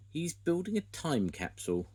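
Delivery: a hint of cheerful Wendy; Wendy's voice. A narrator's reading. Grandad reading Wendy's reply to Muck, lightly voiced. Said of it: "Wendy replied".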